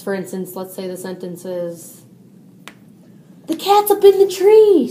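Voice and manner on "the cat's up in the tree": high, loud excited exclamation; excited mom. Shown as a demonstration. Teacher demos an excited mom for the student. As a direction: shout it out with excitement. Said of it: "said Mom, excitedly".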